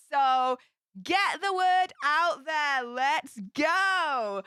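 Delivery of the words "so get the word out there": ordinary talk, nothing marked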